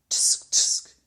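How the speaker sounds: whispered tsk tsk. A narrator's reading. Una tutting at the giant's boast, whispered. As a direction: tut twice under your breath. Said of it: "said Una".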